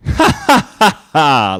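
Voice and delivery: slow staged ha ha ha; a hammed-up villain laugh; mock evil laugh. Twilight gloating over Spike, slow mock laugh. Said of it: "laughed Twilight".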